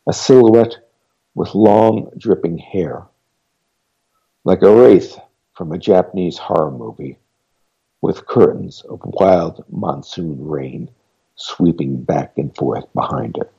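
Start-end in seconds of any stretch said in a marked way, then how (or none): none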